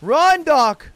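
high, loud shout to flee; urgent shout. Real, not acted. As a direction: yell at him to run. Urging teammate to flee.

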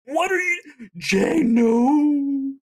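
very high squeal rising to a shout; shocked disbelief. Retold or imitated. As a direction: re-enact stunned disbelief, voice shooting way up. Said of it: "I legitimately was like".

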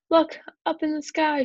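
high, loud storybook call; excited shout. A narrator's reading. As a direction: call out a sighting to everyone. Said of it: "shouted Ryan".

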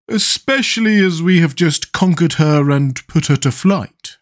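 high, slow character voice; high-voiced pumpkin man adding his point; eager Pumpkinhead. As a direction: chime in high and drawn out.

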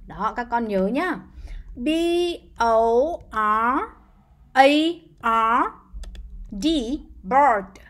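ordinary delivery

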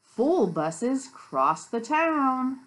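loud, slow storybook reading; read-aloud to children. A narrator's reading. Teacher reads the bus book to children, slow read-aloud.

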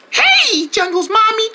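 very high, sing-out hello; sugary put-on greeting. Friend records a message for Jungle's mom, high-pitched greeting.

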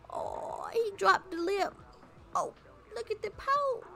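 high, sing-song coo over a pout; cooing baby talk. Pretend. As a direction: gush at the baby's pout.

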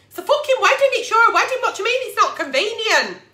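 exasperated outburst. Real, not acted. Exasperated at partner's excuses.

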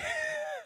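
quick high giggle; a brief laugh at her own insistence; short laugh. Real, not acted. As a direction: giggle after the joke. Laughs after claiming the number.

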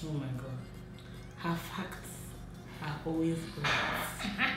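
normal talking voice

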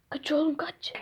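unvoiced whisper, urging to run. A kid whispering to his buddy to run while hiding. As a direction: whisper it so no one hears.

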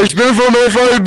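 loud insistent claim; lauter Ruf. Acted. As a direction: shout it, insisting you're FIB. Streit mit Polizist im Spiel. (laut beharrend)

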